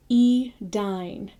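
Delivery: Edine with the vowel as I, eh-DINE; Edine, the A dropped, ending in a long I sound. Shown as a demonstration. Teaching Elvish pronunciation, Edine said slowly and clearly. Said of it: "just say the I".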